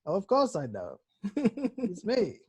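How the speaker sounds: lightly voiced Obi-Wan, rising at end; a hint of playful old Jedi. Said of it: "classic Obi-Wan".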